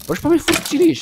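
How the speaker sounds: very high, whiny "why do you keep shooting me?"; exasperated squeal. Real, not acted. Gamer whining at a skeleton that keeps shooting, high exasperated squeal. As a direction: pitch way up in mock exasperation.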